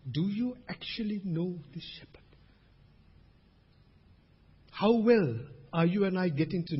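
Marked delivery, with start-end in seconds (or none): 0.1-2.2 softer, half-whispered question; hushed searching question. Real, not acted. Preacher presses congregation on knowing the shepherd, hushed. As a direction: drop to a hush to probe.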